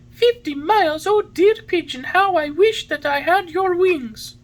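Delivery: very high, loud puppet exclamation; dismayed longing. A narrator's reading. Pinocchio hears it is fifty miles, dismayed. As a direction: cry out high, wishing hard.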